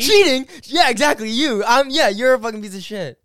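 loud, high-pitched bickering; heated accusation. Real, not acted. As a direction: fire back at full volume. Mock quarrel over cheating.

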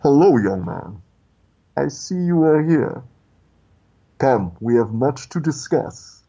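slow, menacing Sith lord impression; Darth Vader. Villain summons a boy into a dark room, slow imposing impression. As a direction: intone it with stately, deliberate pauses. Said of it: "all of a sudden you hear just coming out of there".

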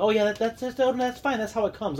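high, breezy brush-off; mock-casual vendor shrugging it off; dismissive seller. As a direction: wave away the complaint as nothing. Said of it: "they're like".

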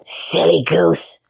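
slow, sneering witch voice. A narrator's reading. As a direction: drawl it like a mocking witch. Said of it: "said the witch".

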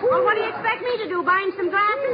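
high, flustered retort; exasperated. Acted. Wife answering husband mid-chase, exasperated. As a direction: throw it back in a high agitated voice.

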